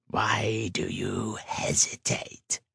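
slow rasping whisper; cold hissing layered whisper. A narrator's reading. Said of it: "a rasping voice called".

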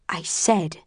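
restrained, terse insistence; understated terse opener. A narrator's reading. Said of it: "my voice is terse".

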